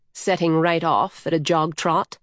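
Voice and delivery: ordinary delivery, nothing marked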